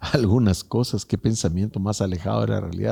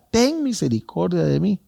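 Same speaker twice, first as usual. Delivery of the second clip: mild cry; 7 semitones higher than usual. Retold or imitated. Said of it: "le grita".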